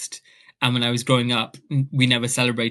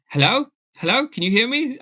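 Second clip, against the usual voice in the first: calling down the line; 10 semitones higher, 5 dB louder than usual. Retold or imitated. Silence on the phone line.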